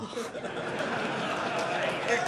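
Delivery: airy chuckle after a joke; light laugh. Real, not acted. Laughs about seniors tour.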